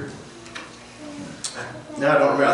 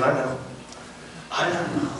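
gentle reassurance; 10 dB quieter than usual. Acted. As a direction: comfort quietly, unhurried. Jesus answering his complaint.

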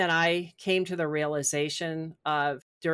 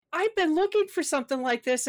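retold delight; 10 semitones higher than usual.

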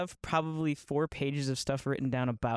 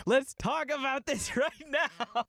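much higher, slow, laughing challenge; mock eager challenge; 10 semitones higher, slower than usual. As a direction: ham up itching for a debate. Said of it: "I'm like".

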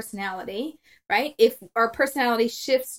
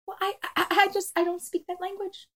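imitated baffled husband; 7 semitones higher, 4 dB quieter than usual. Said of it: "What he's like".

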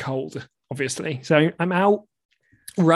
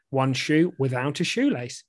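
usual speaking voice

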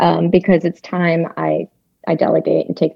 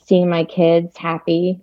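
ordinary talk

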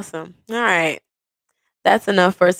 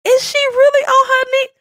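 very high, loud mock disbelief; shrill-pitched comic astonishment; incredulous squeal; 5 dB louder than usual. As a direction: squeal it like you cannot believe it. Voicing partner's disbelief at proposal.

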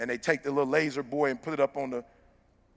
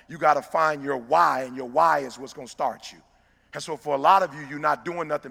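preaching; 4 dB louder than usual. Acted. Motivational speaker rallying the audience, louder full-force preaching. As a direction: build the sermon and drive it at the crowd.

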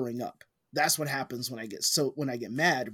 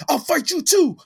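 loud, high mock taunt of a drunk picking a fight; hammed-up drunk bro wanting a fight; drunk challenge; 12 semitones higher, 7 dB louder than usual. Retelling a shirtless drunk friend challenging the Mexican dude, loud mock taunt. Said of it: "But he's like".

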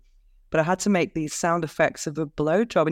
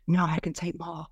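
softer, mock-sultry actress reply; acted porn actress; 5 dB quieter than usual. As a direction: drop softly into the dubbed actress. Acts out dubbed actress's reply.

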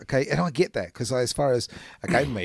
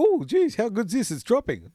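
clueless friend; 10 semitones higher than usual. Retold or imitated. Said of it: "but they go".

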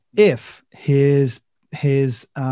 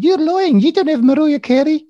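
high, loud, hammed-up kid voice; mock street kids; 14 semitones higher, 7 dB louder than usual. Kids doubting the limo story, in mock high kid voices. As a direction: imitate a disbelieving Liverpool kid. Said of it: "They went".

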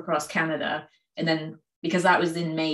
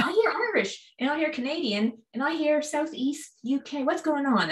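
much higher, lightly voiced puzzled stranger; puzzled listener; 10 semitones higher than usual. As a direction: mimic someone confused by the accent.

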